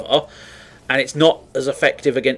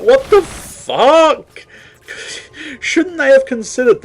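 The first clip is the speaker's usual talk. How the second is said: baffled exasperation; 10 semitones higher, slower than usual. Acted. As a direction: stretch it out in hammed-up disbelief. Acts baffled reaction to game flaw.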